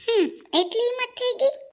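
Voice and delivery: high-pitched shocked question; indignant disbelief. Acted. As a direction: rise sharply in outraged disbelief. Indignant at son-in-law's reported daring. (આઘાત અને રોષભર્યો પ્રશ્ન)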